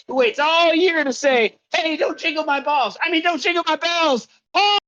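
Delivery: high, projected comic build to the name; announcer introduction. Acted. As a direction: deliver the gag big, like a show host. Comic guest intro with acted quote.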